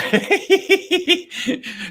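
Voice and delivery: high-pitched run of giggles; high tittering laugh. Real, not acted. Laughs after own remark.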